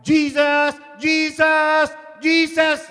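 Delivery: high, loud drawn-out falling cry; shouting. Acted. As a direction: scream for rescue as you plummet.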